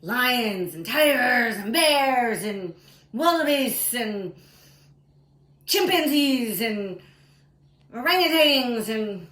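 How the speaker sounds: high, loud storytelling build-up; loud dramatic listing. A narrator's reading. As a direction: call out each beast with mounting alarm. Excitedly lists the wild beasts.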